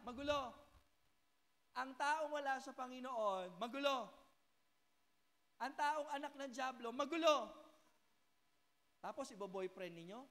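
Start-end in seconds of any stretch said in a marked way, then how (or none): 0.1-7.5 loud repeated "magulo", building to a shout; preaching refrain. Acted. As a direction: hammer the word harder each time. (pasigaw na pag-uulit ng "magulo")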